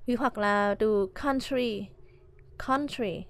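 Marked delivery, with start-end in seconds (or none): 1.2-3.2 country stressed on COUN, falling on try; stress on first syllable. Shown as a demonstration. Teacher models country for students, first-syllable stress. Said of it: "trọng âm ở đầu".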